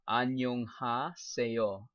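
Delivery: 안녕하세요 spelled out syllable by syllable, slowed down. Shown as a demonstration. Teaching stream viewers Korean, a slowed 안녕하세요. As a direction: ease 안녕하세요 out slowly so learners catch it. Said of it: "I'll say it slower".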